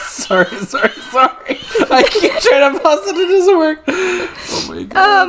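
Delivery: high, loud, laughing through apology; can't stop laughing while saying sorry; laughing hard. Real, not acted.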